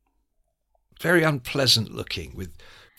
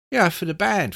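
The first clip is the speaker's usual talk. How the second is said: higher-pitched imitation of a friend; Pete impression; 6 semitones higher than usual. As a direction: answer as the mate, pitched up. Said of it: "he talked like that, Pete".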